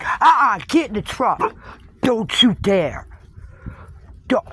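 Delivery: scolding the dogs. Real, not acted. Telling her dog to get in the truck, sharp scolding. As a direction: warn the dog off firmly.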